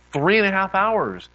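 loud, high retold disbelief; amazed exclamation. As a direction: exclaim it in mock astonishment. Retelling shared amazement at lost hours.

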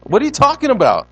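high, loud, quick baffled question; puzzled disciples. Acted. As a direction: act out lost, bewildered followers. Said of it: "they're just kinda looking like".

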